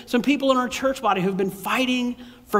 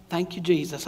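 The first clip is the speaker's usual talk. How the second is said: soft, low Southern "Thank you, Jesus"; hushed Southern church thanks; Southern accent; 5 semitones lower, 6 dB quieter than usual. Real, not acted. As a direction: say it quietly with a Southern twang. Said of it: "wanted to go Southern on that".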